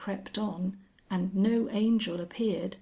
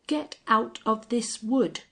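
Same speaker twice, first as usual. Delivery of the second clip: louder, commanding order; angry order; 4 semitones higher, 5 dB louder than usual. A narrator's reading. Sir John ordering the Angel out, angry. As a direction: drive the trespasser out.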